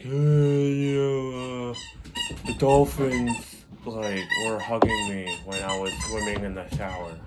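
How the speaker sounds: loopy, dreamy drugged rambling; imitating someone still high from anesthesia; groggy from anesthesia. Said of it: "So it wasn't like".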